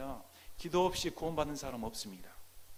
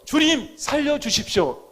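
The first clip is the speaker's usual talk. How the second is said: loud, high plea to the Lord; urgent cry for mercy; 10 semitones higher, 13 dB louder than usual. Acted. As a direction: shout it as a desperate prayer. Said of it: "이렇게 외치지 않을 수가".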